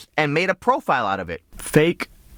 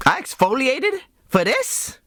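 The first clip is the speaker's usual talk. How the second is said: high, slow incredulous whine; hammed-up disbelief, drawn out and high; mock indignation; 10 semitones higher than usual. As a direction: play the affronted date, pitch rising into a question. Mock outrage at being stood up.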